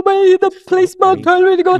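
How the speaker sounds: put-on high, loud little-girl voice; six-year-old girl. Little girl telling her mummy about the policeman, high and loud. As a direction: call to her mummy like an upset child. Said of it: "I was like".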